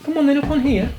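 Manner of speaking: high, sweet-talking a pet; coaxing pet talk. Real, not acted. Inviting Filippo up on here, coaxing.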